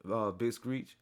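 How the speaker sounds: usual speaking voice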